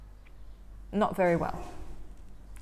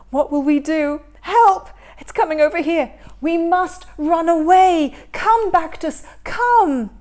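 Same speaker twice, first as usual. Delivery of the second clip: higher and louder frantic pleading; panicked flight; 6 semitones higher, 10 dB louder than usual. Acted. Karius urging Baktus to flee the drill, panicked. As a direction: urge your brother to run for it.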